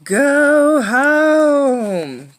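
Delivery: high, loud, howling wind voice; squalling wind. Acted. The wind howls at Irene to turn back, loud and high. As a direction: howl it like a storm wind. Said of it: "the wind squalled".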